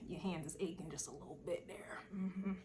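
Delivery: hushed, breathy whisper under the breath; whispered aside. Real, not acted. Whispers to viewers about their sore hands, hushed. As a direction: let it slip out as a secret whisper.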